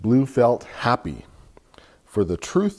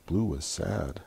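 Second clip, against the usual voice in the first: sad; 3 semitones lower, 9 dB quieter than usual. A narrator's reading. Sad turn in picture book.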